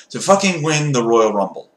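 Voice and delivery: ordinary delivery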